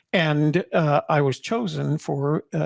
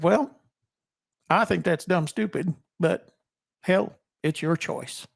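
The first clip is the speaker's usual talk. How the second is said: Southern sergeant; 3 semitones higher than usual. Retold or imitated. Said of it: "He had, he had a Southern accent".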